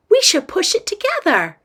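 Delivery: put-on high, perky kid voice; eager little girl. Girl picks another box to push, eager high voice. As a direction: pitch it up like a keen child.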